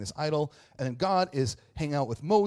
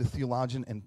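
ordinary talk

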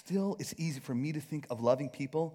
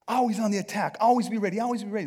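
on the attack; 7 semitones higher, 8 dB louder, faster than usual. Acted. Acts out the attack mindset.

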